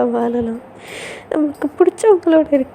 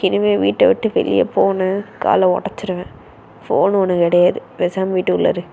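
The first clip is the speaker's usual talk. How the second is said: angry brother on the phone; 6 semitones lower than usual. Acted. Brother scolding his sister's friends over the phone, low and angry. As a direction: warn the friends off, fast and firm.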